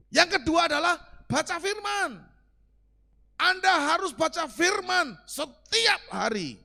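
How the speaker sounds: loud, high, full-force sermon; fiery preaching. Acted. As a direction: hammer the point home to the congregation. (berkhotbah berapi-api, keras dan tinggi)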